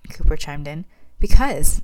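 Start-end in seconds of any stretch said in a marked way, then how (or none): 1.2-1.8 loud, higher-pitched exclamation; exclaiming. A narrator's reading. Mother objecting to the baby's nickname, exclaiming. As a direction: burst out in protest, louder and higher. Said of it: "Amelia exclaimed".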